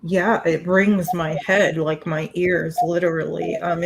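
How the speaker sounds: ordinary talk, nothing marked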